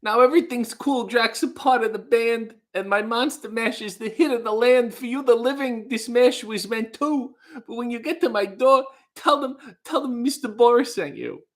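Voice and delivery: badly faked celebrity voice reciting song lyrics; half-sung, put-on comic impersonation; Woody Allen. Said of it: "it's become Woody Allen".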